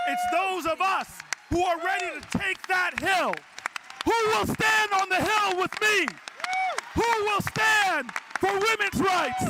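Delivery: shouting the crowd to its feet; preaching at full force. Real, not acted. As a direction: rouse the hall to fight together. Rally climax of convention speech.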